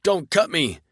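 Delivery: high, loud frantic pleading; panicked begging. Acted. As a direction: beg for your life in a rush. Monster pleads again.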